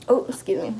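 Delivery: normal talking voice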